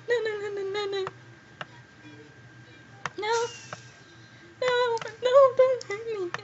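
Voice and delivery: high, frantic pleading for mercy; terrified squeals of no and please; panicked begging. Real, not acted. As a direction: beg the attacker to stop, pitch way up. Being attacked, begs for mercy.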